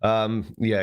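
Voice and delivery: normal talking voice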